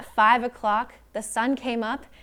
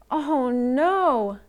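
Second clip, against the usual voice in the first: high, lightly acted sister's cry; dismayed cry; 4 semitones higher, 4 dB louder than usual. A narrator's reading. Sister seeing Baby's fall, crying out in dismay. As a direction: draw out a storybook wail of dismay. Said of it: "Sister cried".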